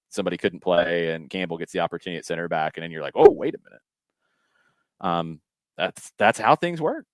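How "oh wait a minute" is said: much higher and louder, surprised; a sharp double take; sudden realization. Retold or imitated. Acted realization in a story.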